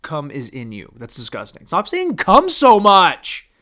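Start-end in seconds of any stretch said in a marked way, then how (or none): 1.7-3.5 mock scolding self. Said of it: "[name], don't say that ever again".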